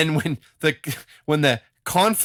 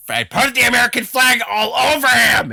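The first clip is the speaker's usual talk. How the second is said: high, loud hammed-up shout; over-the-top flag-waving yell; mock patriotic shout; 13 semitones higher, 7 dB louder than usual. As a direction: shout it like a proud promoter. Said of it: "they just went".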